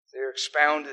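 usual speaking voice

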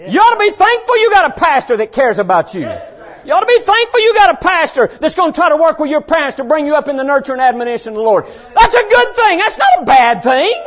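fast, high, forceful exhortation; full-force preaching. Real, not acted. Preacher telling youngsters to be thankful for a pastor, full-force preaching. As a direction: urge the congregation at full voice.

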